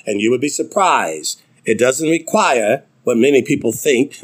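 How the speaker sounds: normal talking voice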